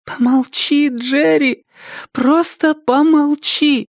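put-on high-pitched girl's voice, slowing at the end; high young girl pleading with a commentator. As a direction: beg him to stop, high and drawn out. Girl silently begs commentator to shut up.

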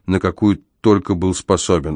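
usual speaking voice

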